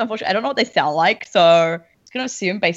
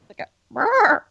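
high rolled brrr like a bird; puffin trill; 11 semitones higher than usual. Pretend. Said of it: "that's my attempt".

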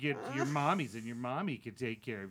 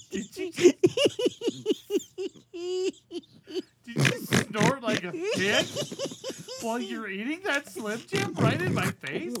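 high, loud disgusted rant; furious high-pitched scolding over the snorting; outraged shriek; 5 dB louder than usual. Acted. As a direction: shriek it at him in disbelief.

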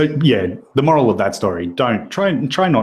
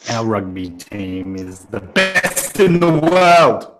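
mock boastful proclamation; 2 semitones lower, slower than usual. Defending the rugby team against the insult, mock proud roar. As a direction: build slowly into a hammed-up team chant.

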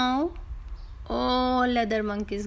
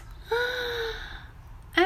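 high gasp; 11 semitones higher, 3 dB quieter than usual. Acted. Vendor discovers empty bag.

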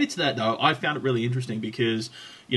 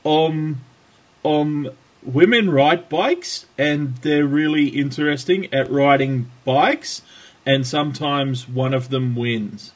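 slow, halting answer, louder than chat; mock interviewee; 3 semitones higher, 5 dB louder, slower than usual. Podcast host roleplays Brian Cookson being interviewed, slow and halting. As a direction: grope through a vacuous answer, slowly and emphatically. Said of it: "sorry, that was unscripted roleplay".

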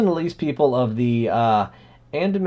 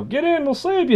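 a high, lightly voiced rescuer calling up; hammed-up call to get in; mock boat rescuer; 14 semitones higher than usual. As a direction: offer a lift from the boat. Boat crew in the joke.

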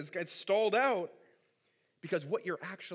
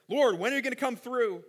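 a touch louder, higher imploring prayer; crying out to God; 5 semitones higher, 5 dB louder than usual. Retold or imitated. Preacher voicing David's gritty prayer, mildly raised plea. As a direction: press the question to God with urgency. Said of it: "he's crying out".

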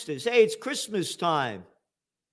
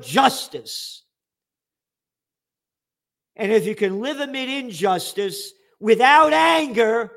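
louder, higher sermon-like declaration; fervent preaching; 6 semitones higher, 4 dB louder than usual. Real, not acted. Host recites Aquinas on righteous anger, fervent preaching. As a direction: preach it with conviction.

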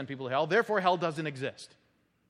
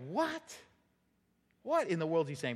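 baffled what; 4 dB quieter than usual. Retold or imitated. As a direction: act out a puzzled listener. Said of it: "you're gonna go".

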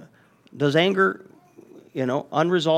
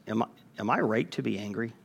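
1 quiet self-questioning; 6 semitones lower, 10 dB quieter, faster than usual. Shown as a demonstration. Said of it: "ask ourselves".

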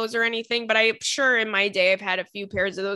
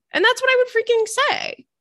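much higher, emphatic declaration; 12 semitones higher, 3 dB louder than usual. Real, not acted. To listeners, insisting what she'd say, excited and high. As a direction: throw it out high and loud. Emphatic after her imagined retort.

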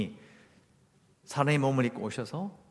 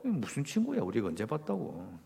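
softer, a sceptical thought to oneself; inner grumble; 5 semitones lower, 8 dB quieter than usual. Acted. Disciple doubting Jesus's friendship, under their breath. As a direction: say it low-key, as a private objection. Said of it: "속으로".

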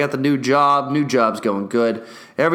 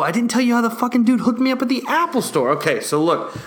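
usual speaking voice

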